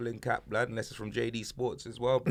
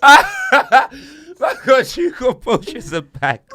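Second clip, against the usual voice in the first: very slow, much higher and louder, stretched through laughter; drawn-out laughing; 13 semitones higher, 14 dB louder, much slower than usual. Real, not acted. Friend mocking a copycat title, stretched out in laughter. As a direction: drag the words out while cracking up.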